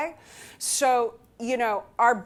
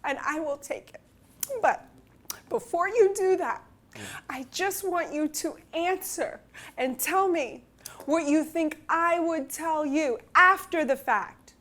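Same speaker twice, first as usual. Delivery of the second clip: tearful plea; 5 semitones higher than usual. Retold or imitated. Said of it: "as I'm crying".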